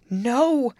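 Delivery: high, loud desperate cry; acted shriek of refusal. A narrator's reading. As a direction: shriek it in protest. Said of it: "she shrieked".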